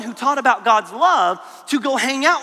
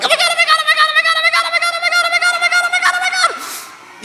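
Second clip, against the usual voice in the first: very high squealing repeated panic; panicked; 5 dB louder, much faster than usual. Retold or imitated. Kid high in a windy tree squealing in panic. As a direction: shriek it like you're stuck in a swaying tree. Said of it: "you're just like".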